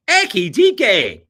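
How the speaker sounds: the name in a hammed-up Nigerian accent, slow, high and loud; mock Nigerian accent on Ekitike, drawn out. Host tries the name in Nigerian accent for a viewer. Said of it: "say Ekitike in a Nigerian accent".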